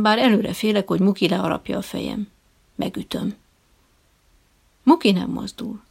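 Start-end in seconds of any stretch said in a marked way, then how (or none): none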